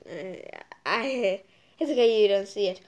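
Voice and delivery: normal talking voice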